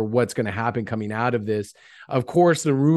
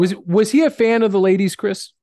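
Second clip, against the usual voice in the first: teasing; 8 semitones higher, 6 dB louder than usual. Real, not acted. Teasing question about the boss.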